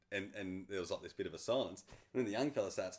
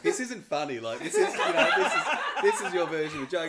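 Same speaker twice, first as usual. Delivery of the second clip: laughing mock protest; 9 semitones higher, 13 dB louder than usual. Retelling reaction to surprise gift.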